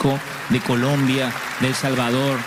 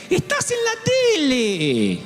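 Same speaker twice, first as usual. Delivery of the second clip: put-on old mother's voice; slower than usual. Mother's confused question.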